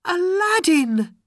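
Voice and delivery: very high, loud joyful call; piercingly high cry of a name. A narrator's reading. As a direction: shout to your long-lost love. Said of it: "she cried".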